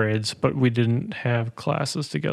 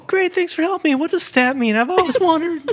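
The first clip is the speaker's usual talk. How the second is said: very high, squeaky complaining caller; fake customer voice. [name] pretends to be a customer in the fake commercial, very high and squeaky. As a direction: play a whiny ad customer. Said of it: "pretend that I was not me in the commercial".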